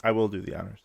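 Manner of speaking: hushed, playful whisper. Pretend. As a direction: whisper it like a secret. Agrees to read the sponsor spot.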